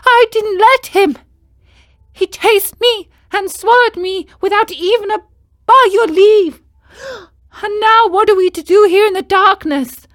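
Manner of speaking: put-on high, loud boy puppet voice; high, loud Pinocchio voice; indignant puppet. A narrator's reading. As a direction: insist you are no fish.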